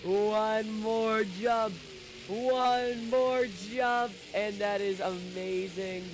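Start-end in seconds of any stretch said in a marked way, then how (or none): 0.0-4.1 drawn out, rising higher each time; slow building hype. Real, not acted. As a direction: stretch it out, building excitement. Chanting up to the final jump.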